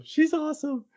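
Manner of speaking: way up high, gushing; excited fan squeal. Shown as a demonstration.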